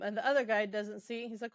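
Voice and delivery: ordinary delivery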